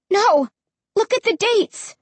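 high, emphatic correction; agitated, high-pitched urging; urgent insistence. A narrator's reading. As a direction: insist they look right now.

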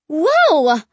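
high exclamation of amazement; startled cry. A narrator's reading. Narrator seeing scarecrows jump back, startled cry. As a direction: cry out in surprise. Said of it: "I cried".

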